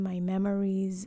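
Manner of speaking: plain reading voice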